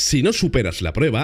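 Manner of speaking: ordinary delivery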